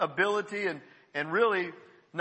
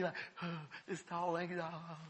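moved to tears; 13 dB quieter than usual. Real, not acted. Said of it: "I get teary-eyed still today".

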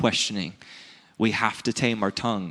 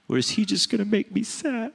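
put-on child's voice; 8 semitones higher, 3 dB quieter than usual. Daughter's imagined thought voiced.

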